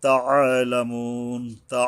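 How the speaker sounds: তাআলামুন drawn slow, throat squeezed on the "'a"; squeezed ayn. Shown as a demonstration. Teacher demonstrates ayn, তাআলামুন with a pressed throat. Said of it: "আইন উচ্চারণে গলা চেপে".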